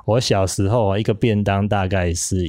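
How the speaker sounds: usual speaking voice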